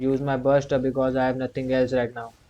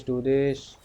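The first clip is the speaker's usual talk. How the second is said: ordinary talk, nothing marked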